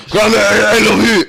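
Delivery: high, loud, hammed-up tipsy confession; mock-tearful drunk phoning granda; drunken love declaration. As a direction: gush at your grandad like a drunk kid. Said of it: "And I was like".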